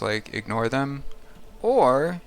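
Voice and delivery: ordinary talk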